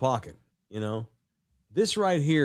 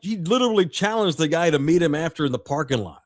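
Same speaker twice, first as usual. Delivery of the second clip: normal talking voice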